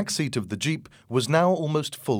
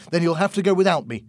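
loud, quick, raised voice; shouted retort; 7 semitones higher, 6 dB louder, faster than usual. A narrator's reading. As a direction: fire back fast and loud. Tony argues back during shouted quarrel.

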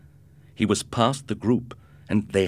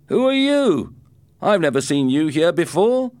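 loud, much higher taunting shout; tough bully; 11 semitones higher, 8 dB louder than usual. Acted. Gang boy challenges the new student, loud high taunt. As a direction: challenge the stranger loudly.

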